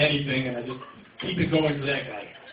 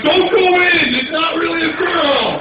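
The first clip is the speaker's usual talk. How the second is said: high, loud mock-panicked yell; shouted warning; 12 semitones higher, 7 dB louder, slower than usual. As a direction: yell it out slowly like warning someone on screen. Acts out warning the man on TV.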